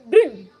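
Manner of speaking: loud, sudden "bing"; imitated danger alarm. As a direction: punch out a mock alarm ping. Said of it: "Danger sign was just going".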